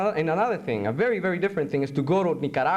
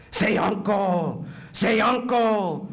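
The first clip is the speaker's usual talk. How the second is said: torturer demanding surrender; 5 semitones higher, much slower than usual. Acted. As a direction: shout it slowly, twice, like an order. Torturer figure in poem.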